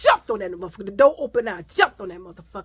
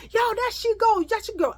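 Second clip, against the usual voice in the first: high mocking call of the girls; sing-song taunt, pitched way up; 11 semitones higher than usual. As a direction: girls jeering from the alley. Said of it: "And I could hear them".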